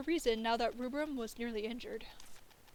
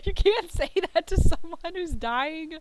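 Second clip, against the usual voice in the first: loud, high, indignant protest; indignant shout; 4 semitones higher, 12 dB louder than usual. Acted. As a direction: yell it, outraged and hurt. Trapped character protests.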